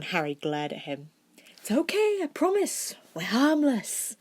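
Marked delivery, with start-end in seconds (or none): 1.6-4.2 soothing twin. Acted.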